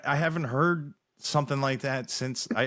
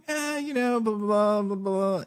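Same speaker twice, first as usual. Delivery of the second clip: mock rambling; 11 semitones higher than usual. Imitates himself riffing on the music.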